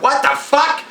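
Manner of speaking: high, startled what-the-fuck; startled exclaim. Retold or imitated. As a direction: gasp it in shock. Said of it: "they're like".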